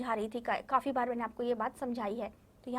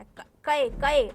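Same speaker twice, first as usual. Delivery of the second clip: ordinary delivery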